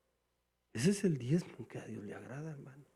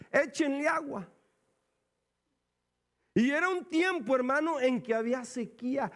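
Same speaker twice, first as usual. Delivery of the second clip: commanding call; 9 semitones higher, 3 dB louder than usual. Acted. Elijah orders water poured.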